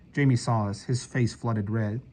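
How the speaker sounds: usual speaking voice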